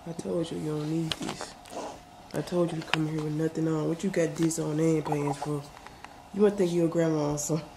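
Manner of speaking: hushed bedtime whisper. Real, not acted. Husband whispering to wife in bed about her pajamas.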